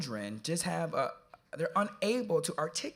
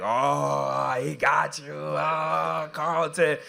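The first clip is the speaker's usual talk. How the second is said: mock teen crowd teasing, a little louder; teasing kids; 5 dB louder, much slower than usual. Imitating young audience members teasing the speaker. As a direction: play the kids ribbing him.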